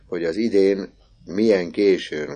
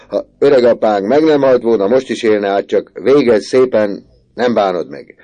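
louder, higher mocking uncle voice, slowing on the first line; teasing uncle; 6 semitones higher, 11 dB louder than usual. Uncle teases Misi for refusing money, hearty mock voice. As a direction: chide him with a loud hearty laugh in the voice.

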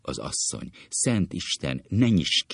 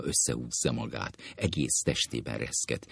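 normal talking voice

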